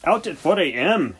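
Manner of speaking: tired farm vet groaning at breakfast. A narrator's reading. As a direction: groan it out, worn out from the early call. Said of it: "he groaned".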